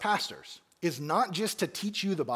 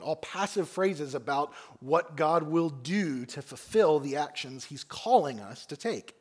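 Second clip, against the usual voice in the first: ordinary talk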